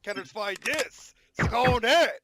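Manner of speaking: slow taunt building to a loud shout; drawn out, then very loud; battle cry. Real, not acted. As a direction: yell it at the enemy in the game. Calling out attack at the game.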